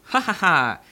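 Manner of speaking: loud mocking evil laugh; villain laugh. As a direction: gloat like a cartoon villain. Villain reveals kidnapping.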